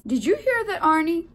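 loud, high question into the phone; loud baker. A narrator's reading. Baker checks Arnie heard the donuts, loud.

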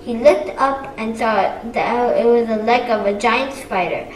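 plain reading voice

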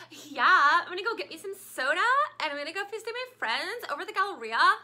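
mock the Dallas teen Valley Girl accent; teacher mocks how Dallas kids talk, in a Valley Girl accent. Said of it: "that's how the kids sound around here".

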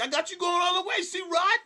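high, loud mocking cheer; mock cheerleader. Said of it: "I'm thinking about them like".

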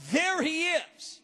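"there" stretched out, slow phrase; stress on THERE. Shown as a demonstration. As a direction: lean slowly on "there". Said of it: "I underline the word".

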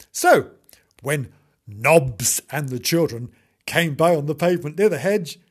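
plain reading voice

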